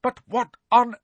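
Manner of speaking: high, indignant teacher's rebuke; angry scolding. A narrator's reading. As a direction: scold them in exasperated outrage. McGonagall rages at the boys.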